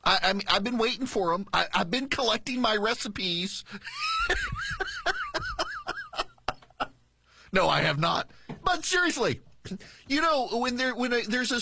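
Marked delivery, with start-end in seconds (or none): 3.7-6.2 very high, thin stuttering cackle; mock cackle. Host joking about cicada recipes, mock high cackle. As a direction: let out a long squeaky put-on cackle.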